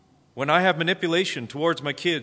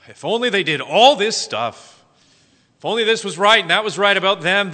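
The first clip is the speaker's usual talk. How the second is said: loud, raised-pitch griping about others; mock complaining; 7 semitones higher, 10 dB louder than usual. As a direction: whine loudly about everyone else's faults. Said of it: "if we were honest in our complaints".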